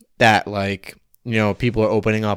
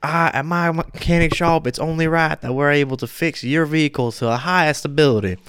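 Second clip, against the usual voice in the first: put-on southern accent; 6 semitones higher than usual. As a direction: ham up a Southern mechanic in a local TV ad. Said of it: "I don't know why I'm doing a southern accent again".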